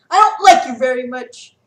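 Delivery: very loud defiance; defiant shout. Acted. Sarah shouting defiance at Quintus while carried off. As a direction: throw it in his face.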